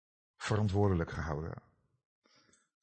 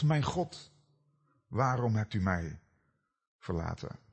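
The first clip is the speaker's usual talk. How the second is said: fading anguish; 3 dB quieter than usual. Acted. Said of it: "En Jezus riep als het ware".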